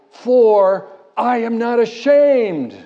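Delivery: high, loud and slow, each word held; slow proclaimed cry. Retold or imitated. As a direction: proclaim it like a banner. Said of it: "He says".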